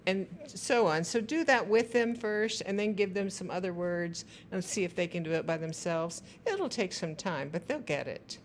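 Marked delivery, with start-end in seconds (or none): none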